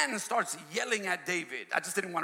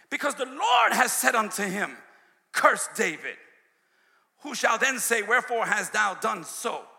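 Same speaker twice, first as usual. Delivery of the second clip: louder, declaiming King James verse; scripture reading; 3 semitones higher, 6 dB louder than usual. Acted. Preacher declaiming scripture to the church.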